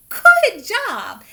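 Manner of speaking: high, loud praise. Real, not acted. Teacher praising child.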